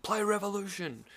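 high-pitched call at ordinary volume; mock yell from the crowd; retold yell. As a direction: imitate calling out a song request to a band. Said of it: "yelled out".

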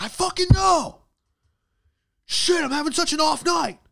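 high, loud hammed-up self-blame; over-the-top screamed mock meltdown; angry at yourself. Worker out-angers his manager over a mistake, screaming at himself. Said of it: "Get angrier at yourself".

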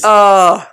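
loud, drawn-out "oh"; swooning moan. Pretend. Partner swooning over a sheriff in jeans, loud moan.

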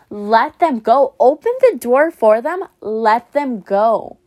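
loud and slow, pressing each word; emphatic urging. Real, not acted. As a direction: push them to finally let go, rising to a near-shout. Urging listener to let go.

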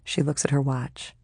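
ordinary delivery

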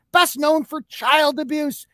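usual speaking voice

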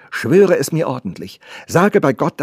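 high, loud demand; urgent, emphatic pleading; insistent girl. Acted. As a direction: press the servant for an oath.

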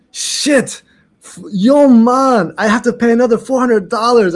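high, loud comic outrage; exasperated protest. Retold or imitated. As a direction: act out dismay at paying up. Retells dismay at another fee.

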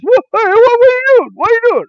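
high, loud panicked student; mock panic. Student to teacher who is photographing his paper, mock panic.

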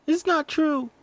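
put-on high tearful child denial; lightly acted crying girl; mock crying. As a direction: whimper the denial. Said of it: "Mercy starts crying".

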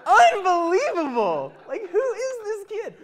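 high, loud and drawn out in disbelief; amazed. Real, not acted. Pastor telling the church about his niece, loud amazement.

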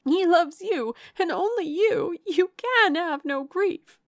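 high, loud, frantic insistence; wild outburst. A narrator's reading. Distraught sister insisting Eleanor can have no grief, wild outburst. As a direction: insist wildly, drawing out the end. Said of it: "cried Marianne wildly".

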